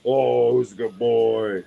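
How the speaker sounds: loud, slow, drawn-out praise; doting pet talk. Retold or imitated. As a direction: gush over the dog, stretching words. Baby-talking the dog.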